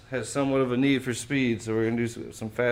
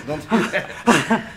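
laughing; 5 semitones higher, 9 dB louder, much slower than usual. Real, not acted. Laughing at a client's joke.

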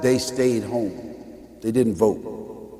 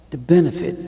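ordinary delivery

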